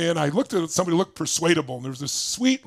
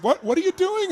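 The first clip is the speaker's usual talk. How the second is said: surprised question; 13 semitones higher than usual. Retold or imitated. Bank lady surprised.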